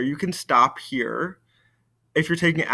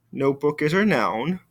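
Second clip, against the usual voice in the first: ordinary delivery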